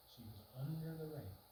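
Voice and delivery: normal talking voice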